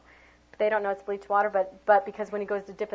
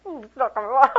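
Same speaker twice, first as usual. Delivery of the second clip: higher, louder mock dismay; mock worried ooh; 4 semitones higher, 8 dB louder than usual. Teacher pretending the spots won't wash off, mock dismay. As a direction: act surprised for the kids. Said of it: "I'm like".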